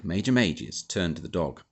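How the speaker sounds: plain reading voice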